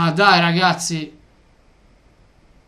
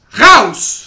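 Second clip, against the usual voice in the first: loud dismissive shout; 9 dB louder than usual. Real, not acted. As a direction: bark it out, much louder. Fan telling coach to leave.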